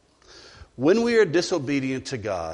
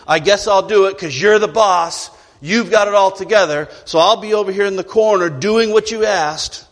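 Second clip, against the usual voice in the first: higher and louder, grudging sarcasm; mock the boss while agreeing; resentful employee; 5 semitones higher, 6 dB louder than usual. Sulky employee grudgingly agrees to the boss's request.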